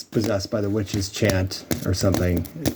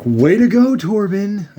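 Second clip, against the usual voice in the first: sarcastic; 10 semitones higher, 4 dB louder, slower than usual. Retold or imitated. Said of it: "it's like".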